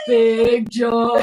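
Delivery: loud, booming call of the name. Pretend. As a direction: announce him like a ring name. Calling out the name.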